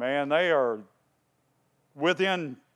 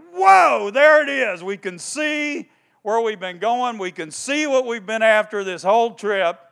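high, loud thrilled travelers; excited; 7 semitones higher, 7 dB louder than usual. Retold or imitated. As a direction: burst with relief at the goal. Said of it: "And they're excited".

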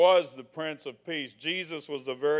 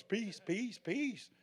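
hippie murmur; 4 semitones higher, 9 dB quieter, much slower than usual. Retold or imitated. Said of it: "I grew up in the sixties".

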